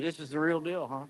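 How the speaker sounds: normal talking voice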